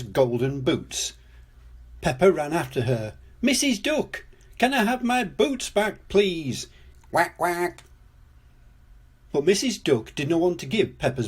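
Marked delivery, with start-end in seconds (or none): 3.4-6.7 high-pitched plea of a little character; child calling out. Acted. Pepper calls after fleeing duck. 7.1-7.8 higher-pitched spoken quacks; duck quacking. Acted. Duck answers Pepper.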